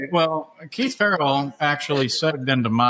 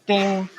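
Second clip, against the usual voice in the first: imitated notification ding; 9 semitones higher than usual. Imitating the follow notification sound.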